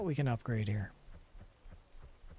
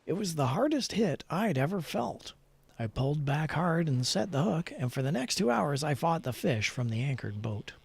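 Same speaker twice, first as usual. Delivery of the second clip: a slightly louder storyteller read; story narration; 2 semitones higher, 5 dB louder than usual. Acted. Player reading the in-game fishing story aloud in a storyteller voice. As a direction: read it like a true-adventure tale.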